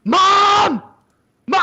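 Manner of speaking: loud, high panicked shout up the stairs; yelling for mom. Retold or imitated. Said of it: "I yelled up".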